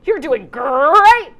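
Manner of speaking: slow, loud cheering encouragement; encouraging cheer. Retold or imitated. Tony the Tiger cheering in the kitchen, slow loud encouragement. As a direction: cheer them on from the sidelines. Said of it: "someone in the background to just be like".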